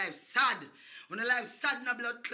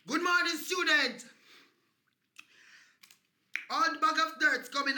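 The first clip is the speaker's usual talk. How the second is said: high, loud mock teacher greeting; 6 semitones higher, 4 dB louder than usual. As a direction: greet the class like a strict teacher. Mock teacher taking register.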